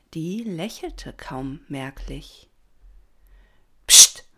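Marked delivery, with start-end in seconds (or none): 3.9-4.3 sharp loud "psst"; shushing. A narrator's reading. Patron silences the chattering swarm, loud shush. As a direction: hush the whole crowd. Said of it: "machte der Patron".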